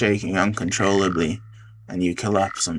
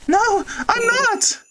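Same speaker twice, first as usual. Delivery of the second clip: a much higher, slow crying protest; crying denial; slower than usual. Acted. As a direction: wail it through tears. Character cries out denial.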